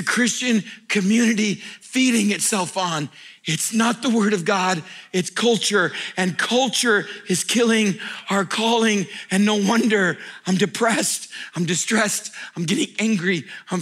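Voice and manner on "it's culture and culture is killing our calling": ordinary talk, nothing marked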